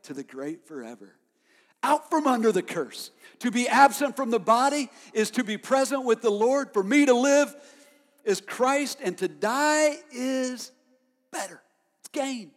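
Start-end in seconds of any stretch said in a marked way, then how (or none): 1.8-12.5 high, loud scripture proclamation; preacher roaring Paul's words; full-force preaching. Acted. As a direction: shout the verse in triumph.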